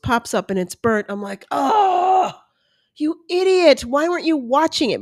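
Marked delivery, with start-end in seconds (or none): 1.5-5.0 high-pitched exasperated groan into a scolding; annoyed self-scolding. Retold or imitated. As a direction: berate yourself over burnt toast. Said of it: "I talk to myself like I'm a three-year-old brat".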